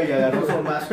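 ordinary talk, nothing marked

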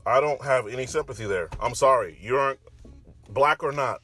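normal talking voice

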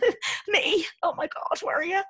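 put-on very high, girlish flattered act; coy feigned surprise. Woman flattered by the barman's attention, coy feigned surprise. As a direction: play innocent, way up high.

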